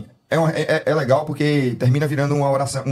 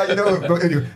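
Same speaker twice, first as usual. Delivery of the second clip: normal talking voice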